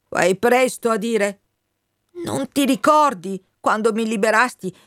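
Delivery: indignant, then high and insistent; impassioned Wind figure. Acted. Wind reminds the colonel of his sworn loyalty, impassioned. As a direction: press an old oath on a friend.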